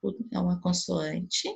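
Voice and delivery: usual speaking voice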